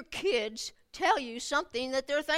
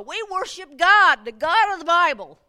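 preaching shout; 6 semitones higher, 12 dB louder than usual. Real, not acted. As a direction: proclaim it with full force. Preaching climax.

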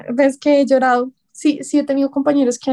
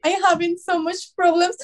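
higher, softer tearful English; tearful plea; 6 semitones higher, 4 dB quieter than usual. Retold or imitated. Agent pleading to the client, mildly tearful. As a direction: choke it out near tears. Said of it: "se dio cuenta que yo estaba llorando".

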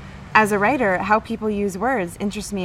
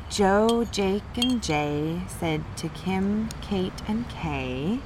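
slow read-aloud; 4 dB quieter, much slower than usual. Shown as a demonstration. Sounding out a book line.

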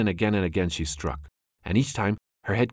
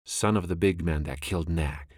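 usual speaking voice

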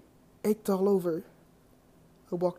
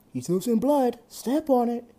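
higher, louder urgent shout to the driver; urgent yell; 7 semitones higher, 6 dB louder than usual. A narrator's reading. Paramedic yelling at the ambulance driver, urgent loud shout. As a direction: yell it as the patient bleeds out. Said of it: "yelled the paramedic".

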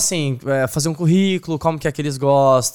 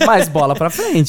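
rindo; 4 dB louder, slower than usual. Real, not acted. Ri da entrevista que deu errado.

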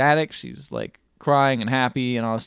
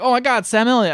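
mock starstruck; 10 semitones higher than usual. Voicing excited Gaga character.

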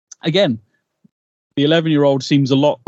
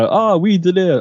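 higher-pitched, hammed-up French accent; lightly mock a French singer; French guy; 7 semitones higher, slower than usual. Podcaster imitating the French singer from the episode, mock French accent. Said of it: "with the French guy".

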